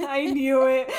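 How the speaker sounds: loud, high laughing shout; laughing burst. Real, not acted. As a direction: crack up, you called it. Friend guessed the coffee.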